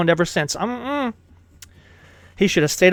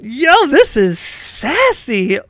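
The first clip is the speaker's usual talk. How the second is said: slow, drawn-out reaction with swooping pitch; scandalized delight; 5 semitones higher, 4 dB louder, much slower than usual. Real, not acted. Streamer reacting to a sassy game scene, scandalized and drawn out. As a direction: stretch the key words in mock shock.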